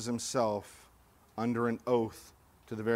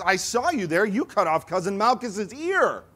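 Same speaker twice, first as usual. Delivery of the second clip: excited accusation; 9 semitones higher, 9 dB louder than usual. Acted. Garden witness accusing Peter.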